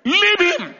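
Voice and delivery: high, forceful preacher's shout; shouted command. Retold or imitated. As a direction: shout an order to a demon. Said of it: "I shouted".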